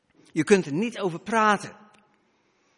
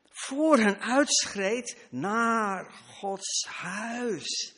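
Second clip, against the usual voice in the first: 1 solemn recital; 4 semitones higher, much slower than usual. Acted. Psalm quoted, slow and solemn.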